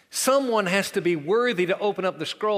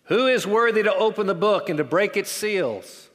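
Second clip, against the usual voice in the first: raised, proclaiming voice louder than the reading; loud proclamation; 4 semitones higher, 6 dB louder than usual. A narrator's reading. Strong angel proclaiming to all heaven, loud. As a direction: call it out like a herald angel. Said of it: "proclaiming with a loud voice".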